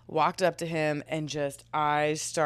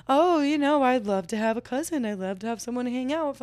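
higher, faster mock of the friend; mimicking friend's voice; 7 semitones higher, 3 dB louder, faster than usual. Friend's voice hinting she wants a baby. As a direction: lightly play the friend, sing-song and quick.

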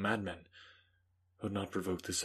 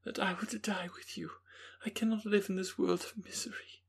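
high, youthful woman's voice; young Elizabeth; 12 semitones higher than usual. Acted. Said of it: "cried she".

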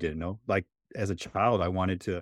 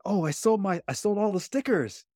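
lightly voiced small boy, much higher; a hint of an innocent young child, pitched up high; little kid self; 12 semitones higher than usual. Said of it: "And I said".

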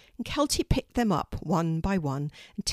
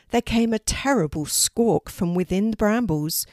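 usual speaking voice

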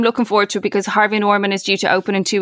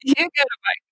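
Oprah Winfrey giveaway; 4 semitones higher, 4 dB quieter than usual. Pretend. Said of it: "You're like Oprah Winfrey".

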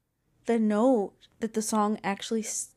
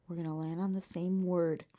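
whispering; 6 semitones lower, 9 dB quieter than usual. Real, not acted. Keeping quiet at bedtime.

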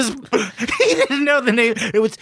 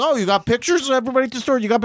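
mock coworker; 9 semitones higher, much faster than usual. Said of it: "And he'd be like".

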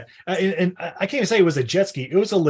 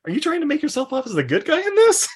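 incredulous; 10 semitones higher, faster than usual. Retold or imitated. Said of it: "you know, like".

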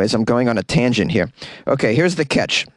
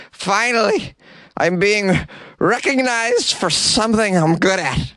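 proud volunteer voice; 6 semitones higher than usual. Pretend. Imagined proud volunteer speaking.

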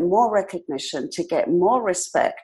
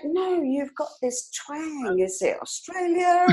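imitating curious Brits; 8 semitones higher, 5 dB quieter, slower than usual. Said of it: "They're like".

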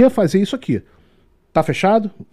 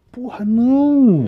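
dismayed groan; 9 semitones higher, 5 dB louder, much slower than usual. Retold or imitated. Guide's dismay retold.

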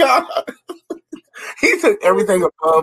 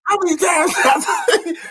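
high, slow, drawn-out yell; mock shout; 12 semitones higher, much slower than usual. Friend quoting Varnell Hill's shout from the sitcom, high and slow. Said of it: "He said".

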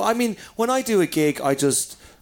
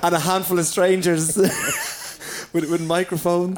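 talking through real giggles, higher voice; laughing; 4 semitones higher than usual. Real, not acted. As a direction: laugh your way through the words. Joking about the small gig.

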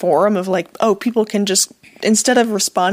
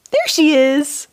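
higher, doting coo to a pet; pet talk; 7 semitones higher, much slower than usual. Real, not acted. Owner spots the pet coming out, cooing pet talk. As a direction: greet the little pet in a higher voice.

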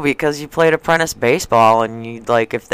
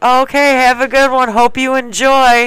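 put-on nonchalance; 10 semitones higher than usual. Crew hiding laughter as the piercer goes in, put-on nonchalance. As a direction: act casual in a much higher voice. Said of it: "be as nonchalant as possible".

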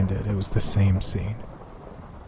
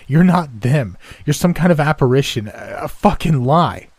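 loud, higher-pitched furious denial; raised voice, outraged and disbelieving; shouting; 5 semitones higher, 5 dB louder than usual. A narrator's reading. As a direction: shout accusingly at the impostor. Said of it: "I stood up, shouting".

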